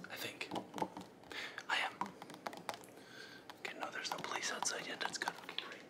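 soft breathy ASMR whisper, nervous underneath; hushed ASMR voice with jittery nerves. Acted. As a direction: whisper like a creepy ASMR host. Whispering intruder.

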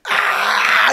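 sudden high breathless scream; voiceless high shriek of terror; imitated scream. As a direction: shriek it out like the guard in a panic. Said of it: "I hear him screaming".

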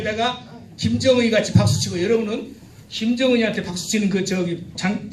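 ordinary talk

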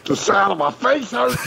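very high-pitched mock whine; exaggerated high pained voice; Yuck. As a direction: squeal the complaint to tease a friend. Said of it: "I wanna hear you guys' impressions".

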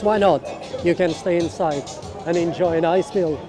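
ordinary talk

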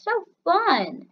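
slow, high-pitched mock delight; sarcastic gushing. Player pretending to love shooting in Minecraft, sarcastic gushing. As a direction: stretch out the fake fun. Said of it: "I was being sarcastic".

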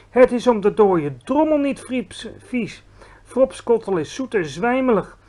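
a giant who can't believe the question; indignant giant. Acted. Giant correcting Sophie about fropskottel, offended.

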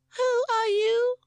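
very high, loud, drawn-out squeak. A narrator's reading. Cross chick to its captor, loud squeak. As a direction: squeak a slow, loud demand. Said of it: "it squeaked".

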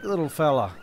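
ordinary talk

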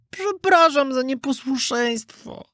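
high, slow, drawn-out apology; apologetic puppet. A narrator's reading. Puppet back home apologising to the fairy, contrite. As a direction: say sorry in a small high puppet voice.

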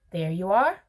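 loud, lifted call; crying out. A narrator's reading. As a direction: cry it out with a rising finish. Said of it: "cried Mister Wonka".

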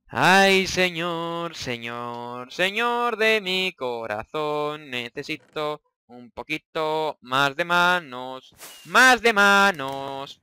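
slow, drawn-out mock plea to heaven; mock prayer. Gamer begging the lord for extra hands, slow mock prayer. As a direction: pray theatrically for help.

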